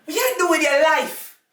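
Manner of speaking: high, loud disbelieving shout; loud, high incredulous yelling, half laughing. Acted.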